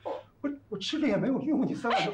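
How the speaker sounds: loud, high-pitched reproach; upset outburst. Acted. Husband reproaches his wife over useless supplements, upset outburst. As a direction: burst out in dismay, pitch and volume up.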